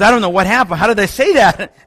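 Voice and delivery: loud, high-pitched mock panic; retold shock. Retold convert shocked at own outburst.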